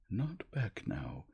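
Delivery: plain reading voice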